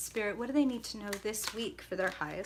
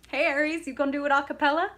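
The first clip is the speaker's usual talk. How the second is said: playful tease; 5 semitones higher, 6 dB louder, faster than usual. Real, not acted. Teasing Aries.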